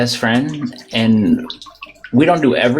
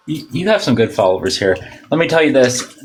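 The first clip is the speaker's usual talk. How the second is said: ordinary delivery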